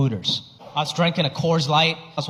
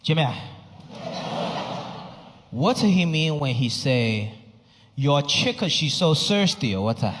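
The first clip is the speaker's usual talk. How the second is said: lower, slow puzzled father; Chinese dad accent; 4 semitones lower than usual. Retold or imitated. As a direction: ask like a confused old dad. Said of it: "dad asks again".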